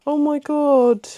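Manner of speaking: high, loud, slow gasp of delight; overwhelmed gasp. Real, not acted. Seeing the last stickers, a high tearful gasp. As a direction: breathe it out, moved to tears.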